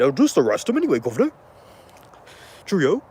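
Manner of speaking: lightly put-on British accent, posh officer; a hint of British accent from a jolly officer; mock British accent. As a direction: play a British bobby with a cheerful Cheerio. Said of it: "I had to do that".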